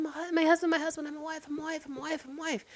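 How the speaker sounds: hammed-up devoted wife chant; mock clingy wife. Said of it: "she was like".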